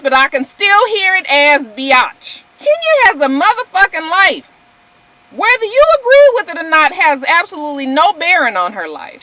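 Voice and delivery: loud, forceful rant; heated, emphatic scolding; angry telling-off. Real, not acted. Rant aimed straight at Susie.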